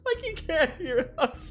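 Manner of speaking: laughing. Real, not acted. Laughing at memory.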